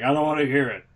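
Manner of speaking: put-on half-hearted Bulgarian accent, brushing someone off; Rusev impression. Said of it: "that's a good Bulgarian accent".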